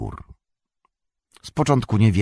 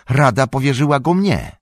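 raised, higher voice in firm refusal; okrzyk; 7 semitones higher, 5 dB louder than usual. A narrator's reading. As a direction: cry out in Frodo's defence. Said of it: "krzyknął Frodo". (podniesiony, stanowczy głos)

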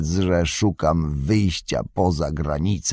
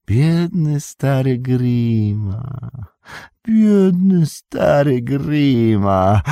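mock whining; 5 semitones higher, 3 dB louder, slower than usual. A narrator's reading. Saruman mocks Grima's whining.

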